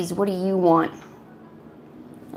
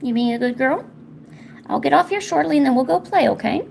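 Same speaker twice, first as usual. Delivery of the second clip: high singsong call to the cat; pet talk; 6 semitones higher than usual. Real, not acted. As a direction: coo at the cat, pitched up.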